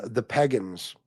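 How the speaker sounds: put-on "pagans" as "peggins", Philly accent. Mimicking how his friend pronounces "pagans", Philly accent. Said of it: "It's that Philly accent".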